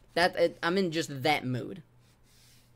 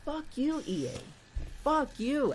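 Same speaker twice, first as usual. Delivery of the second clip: high frustrated curse; 9 semitones higher, 3 dB quieter than usual. Real, not acted. Cursing EA, high.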